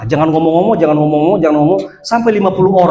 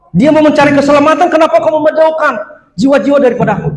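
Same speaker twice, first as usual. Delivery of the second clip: preaching rebuke; 9 semitones higher, 3 dB louder, faster than usual. Real, not acted.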